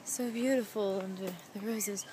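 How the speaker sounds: soft, mock-romantic murmur to herself; whispering sweet nothings. Alone in the rose garden, soft mock-romantic murmur. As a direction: coo it softly to yourself, playing lovers. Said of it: "whispering sweet nothings to myself".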